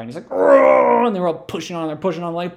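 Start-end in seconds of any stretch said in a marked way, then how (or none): 0.3-1.1 loud, high straining growl; big guy's roar. Retold or imitated. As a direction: roar like a buff man holding a door. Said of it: "like, this big buff guy".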